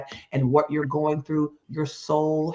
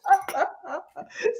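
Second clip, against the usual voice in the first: high giggle. Real, not acted. Guest laughs after surprised yelp.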